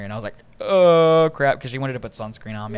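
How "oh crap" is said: loud, higher "oh crap" of being caught; drawn-out groan of dawning trouble; mock dread. Drunk teen called over by mom, loud mock dread.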